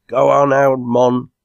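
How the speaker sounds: loud, high urging shout; crying out. A narrator's reading. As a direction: spur the old mate on. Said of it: "cried Enoch".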